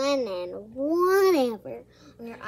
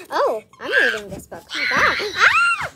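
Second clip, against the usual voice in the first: pretend screaming; 3 dB louder than usual. Sudden shaking, screaming kids.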